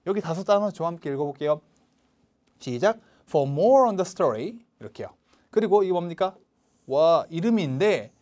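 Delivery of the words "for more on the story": "more" punched, rising at the comma; slow, lean hard on "more"; stress on more. Shown as a demonstration. Teacher showing stress on the comparative, punching "more". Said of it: "more, 비교급".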